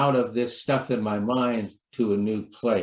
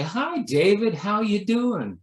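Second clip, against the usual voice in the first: cheery greeting; 8 semitones higher than usual. Retold or imitated. Friend greeting the minister, bright and high. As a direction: greet him sunnily like a friend dropping by. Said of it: "come up to me and say".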